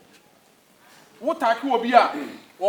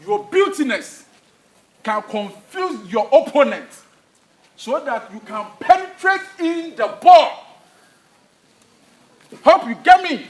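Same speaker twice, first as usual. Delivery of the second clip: rousing coach shout; 4 semitones higher, 6 dB louder than usual. Acted. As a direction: whip the team up like a fired-up coach. Coach's rousing pitch-side speech.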